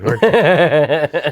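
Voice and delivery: slow, drawn-out tag question through laughter; laughing. Retold or imitated. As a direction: drag it out, barely holding the laugh in. Cheeky reply to the cop.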